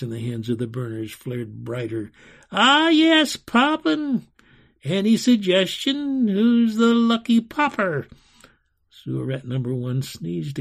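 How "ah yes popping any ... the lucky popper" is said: high, loud, theatrical relish; gleeful tyrant enchanter. A narrator's reading. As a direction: crow over the idea to the whole room.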